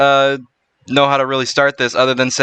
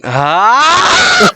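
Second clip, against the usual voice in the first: slow intro breaking into a yell; startled shout; 13 semitones higher, 5 dB louder than usual. Acted. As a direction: draw it out, then yell. Intro cut off by yelp.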